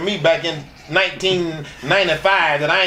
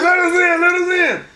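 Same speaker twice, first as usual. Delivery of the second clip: mock pounding on door; 12 semitones higher than usual. Banging on the hotel door.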